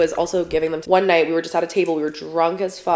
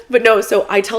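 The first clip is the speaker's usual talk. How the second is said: ordinary talk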